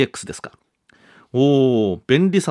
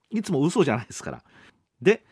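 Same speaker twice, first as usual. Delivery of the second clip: ordinary delivery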